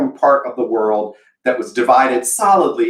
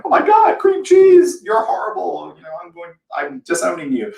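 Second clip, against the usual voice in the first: mock horrified Southerner; 4 semitones higher than usual. Southern viewers disowning her for cream cheese, hammed-up horror. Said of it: "Southern people out there going".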